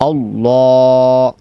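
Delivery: আল্লাহ with a thick, full L and a long drawn vowel; full heavy Allah. Shown as a demonstration. Teacher demonstrating the heavy L in আল্লাহ for students. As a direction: say আল্লাহ with the mouth wide and the L heavy. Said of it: "বলতে হবে".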